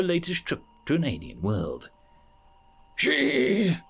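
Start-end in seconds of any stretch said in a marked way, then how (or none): 3.0-3.8 high, wailing cry. Acted. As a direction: wail the word like a mourning alien. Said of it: "Chorus cried".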